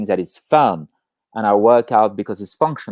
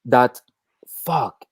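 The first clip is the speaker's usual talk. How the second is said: unvoiced awed curse; hushed whispered fuck; whispered wow. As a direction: breathe the word out in wonder. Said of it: "aha moment".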